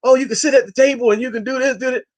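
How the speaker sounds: high, sugary mock invitation; lightly voiced pushy hosts; mock eager inviters. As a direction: ham up the flattering hosts. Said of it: "they said to Imam Fahim".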